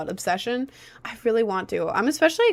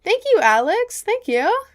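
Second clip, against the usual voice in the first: high sing-song thanks; 11 semitones higher, 4 dB louder than usual. Real, not acted. Thanking chatter.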